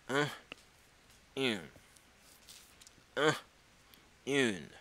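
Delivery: "un" nasal, "une" yn, said slowly and clearly; drill un and une. Shown as a demonstration. Teacher asks students to repeat, clear un and une. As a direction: alternate "un" and "une" for the class to copy. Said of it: "répétez".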